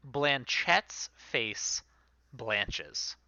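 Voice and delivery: usual speaking voice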